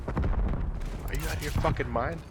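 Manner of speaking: low, soft stern reprimand; imitated principal's quiet telling-off; hushed scolding. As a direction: chew him out under your breath. Principal privately scolds teacher.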